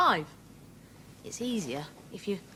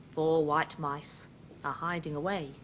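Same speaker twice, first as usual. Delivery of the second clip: ordinary talk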